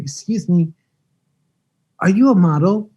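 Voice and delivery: retold Australian accent, lightly voiced. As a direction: voice the Australian stranger politely asking. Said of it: "speaking in an Australian accent".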